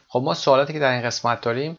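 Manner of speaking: ordinary talk, nothing marked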